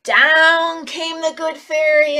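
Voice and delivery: very slow, drawn-out story line; dramatic storytelling. Acted. Teacher telling the fairy's arrival to children. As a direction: stretch it out slowly.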